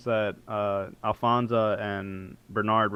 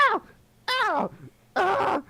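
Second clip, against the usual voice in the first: much higher, tiny pained cries; squeaky bat yelps; slower than usual. Acted. Punched bat illusion yelps in pain, high squeaks. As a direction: squeal like a hurt little bat. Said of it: "screaming and crying".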